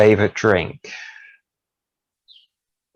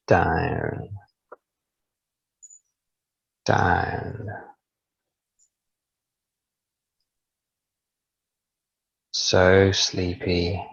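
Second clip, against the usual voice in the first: slow soothing countdown; slower than usual. Acted. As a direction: count them down into sleep. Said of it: "as I slowly count backwards from ten to one".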